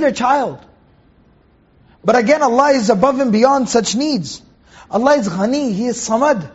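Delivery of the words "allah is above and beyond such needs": normal talking voice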